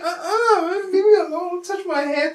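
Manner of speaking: put-on high, loud, whimpering kid voice; whiny little kid. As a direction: whine it like a toddler being pestered. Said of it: "Momo would be like".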